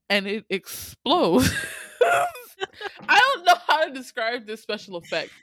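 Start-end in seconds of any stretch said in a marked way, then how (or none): 1.5-3.0 high squealing laugh; helpless laughter. Real, not acted. Cracking up at exploding dog.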